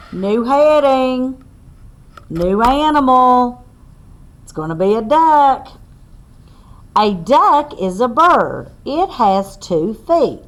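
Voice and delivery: slow, loud read-aloud to a class; reading to children. Acted. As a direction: spell it out clearly for little listeners. Teacher reads picture book aloud.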